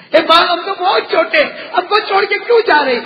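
put-on loud, fast, desperate child's plea; children at the dying mother's feet, loud pleading; pleading cry. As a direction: beg your mother not to leave, loud and rushed. Children begging their dying mother.

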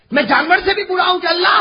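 high, loud cry to God; anguished outcry. Retold or imitated. Saint confessing his lowliness to Allah, crying out. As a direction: cry the lament out at full force. Same lament, cried out.

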